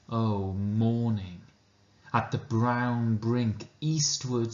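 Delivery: slow, deliberate poetry recital; measured reverent reading of Hopkins; poem reading. Acted. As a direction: savour each line of the sonnet with long pauses. Reads the sonnet aloud.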